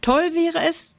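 high-pitched, lightly voiced girl's exclamation; girl calling out. A narrator's reading. Girl proposes summer carnival, calling out. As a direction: pitch it way up, enthusiastically. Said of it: "Ein Mädchen aber rief".

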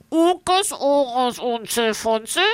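nachgeäfft mit zugehaltener Nase. A narrator's reading. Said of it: "Er hält sich die Nase zu und wiederholt". (Hotte äfft das Wesen nach, mit zugehaltener Nase)

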